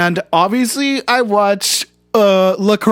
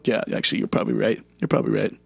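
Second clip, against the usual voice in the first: sheepish admission; 8 semitones lower, 7 dB quieter, much faster than usual. Acted. Quiet reluctant admission.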